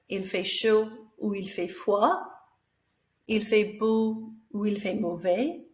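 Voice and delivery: il fait chaud ou il fait froid with the voice going up on froid; rising either-or questions. Shown as a demonstration. Teacher showing pupils that a rising voice makes a question. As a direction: lift the end to turn statements into questions. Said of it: "making our voice go up".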